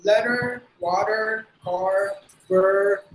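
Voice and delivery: deliberate American accent, word by word. Shown as a demonstration. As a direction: round every R into an American "er". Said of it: "they will apply the sound of er". (அமெரிக்க உச்சரிப்பு)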